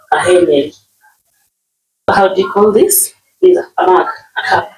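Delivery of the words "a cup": ordinary delivery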